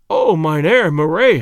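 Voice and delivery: loud, drawn-out call; crying out. A narrator's reading. Young man hails the man who thinks him a ghost, crying out. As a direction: call his name out, long and loud. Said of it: "I cried".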